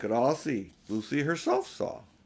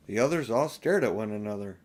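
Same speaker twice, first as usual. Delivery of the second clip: usual speaking voice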